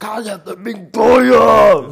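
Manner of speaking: mocking shrill angry yell of "Cállate, Pincoya"; imitated angry shout. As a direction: yell it like a furious reality show contestant. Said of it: "Le salió otra voz". (grito enojado imitado, burlón)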